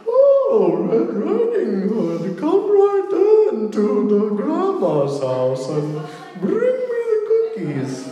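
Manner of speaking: very high, slow German granny falsetto; drawn-out, hammed-up German old-lady voice; German grandma. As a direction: coo it like a wolf faking a German grandma. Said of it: "it's like a German grandma".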